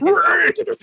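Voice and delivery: put-on high, loud eager kid shout; sudden yell. Kid yelling to sell wreaths.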